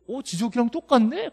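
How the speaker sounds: 웃으며 흉내 내는 학생 말투; 웃음 섞인 높은 목소리로 학생을 흉내 내기; mocking student. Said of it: "그러고 있잖아요". (웃으며 학생 말을 흉내 내는 강사)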